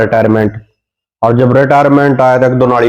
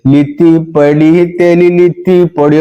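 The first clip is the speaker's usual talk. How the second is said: high, slow lisping singsong; lisping girl mocking the soldier in song; 5 semitones higher, slower than usual. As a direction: sing it with a childish lisp. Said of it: "तोतली ने फिर कहने दे".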